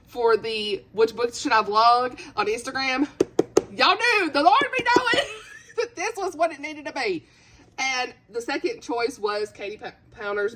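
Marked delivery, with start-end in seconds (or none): 3.7-6.9 high, wailing laugh-cry; overwhelmed outburst. Real, not acted. Reader thanking followers who picked the book, overwhelmed. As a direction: burst out high, laughing through the emotion.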